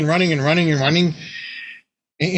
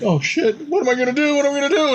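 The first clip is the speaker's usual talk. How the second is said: high panicky mock fear, rising; panicked player; 12 semitones higher, faster than usual. Player fleeing the god down the hall, high mock panic. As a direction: squeak it out, cornered and frantic. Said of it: "He's like".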